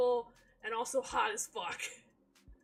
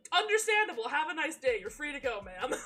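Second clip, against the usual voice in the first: sarcastic, breezy sendoff, pitched higher and louder; mock dismissive; 3 semitones higher, 7 dB louder than usual. Streamer mockingly waves off a confessed killer, sarcastic. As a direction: wave her off like a clerk.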